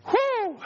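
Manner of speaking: high excited whew; high whoop. Real, not acted. Pastor breaking into energy after the prayer, whooping. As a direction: let out a burst of energy.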